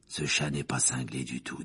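low rasping whisper of a haunted man. A narrator's reading. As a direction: breathe it out low and rough.

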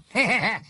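high, harsh burst of laughter; a scraping, bitter laugh; grating laugh. Acted. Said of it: "éclata d'un rire grinçant".

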